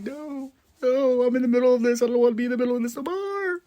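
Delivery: high, rushed mock whine; mock wailing protest. [name] refusing to be caught in between, mock wail. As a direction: wail it fast in a put-on high voice.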